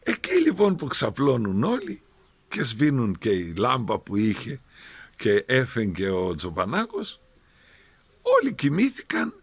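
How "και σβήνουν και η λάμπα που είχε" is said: ordinary talk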